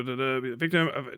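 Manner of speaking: ordinary talk, nothing marked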